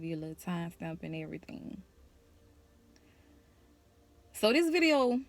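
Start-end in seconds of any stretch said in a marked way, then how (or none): 4.3-5.2 a high, loud kickoff; energetic start. Real, not acted. Vlogger starting her story to viewers, high and loud. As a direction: launch the story with a burst.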